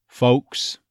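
"Folks" with O starting on schwa; Texas "Folks". Shown as a demonstration. Coach models the word for learners, Texas "Folks". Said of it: "neutralizing the first half".

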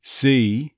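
"See" as "SUH-ee"; stressed "See" easing in from a schwa; on-glide E. Shown as a demonstration. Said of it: "there's that on glide onto the E sound".

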